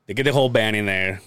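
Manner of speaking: ordinary talk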